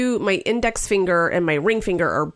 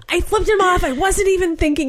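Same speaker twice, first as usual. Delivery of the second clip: flustered excuse; 11 semitones higher than usual. Retold or imitated. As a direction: babble it out defensively.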